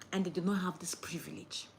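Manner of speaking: sombre whisper. Real, not acted. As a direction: drop to a hushed whisper for the needy. Sombre lowered voice.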